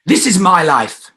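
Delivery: loud high "This is my life"; stronger declaration. Shown as a demonstration. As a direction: declare it with power. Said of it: "it's gonna be stronger".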